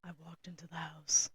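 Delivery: low whispery put-on man narrator; fake deep man voice. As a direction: read like a woman faking a man. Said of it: "a lady doing the male perspective".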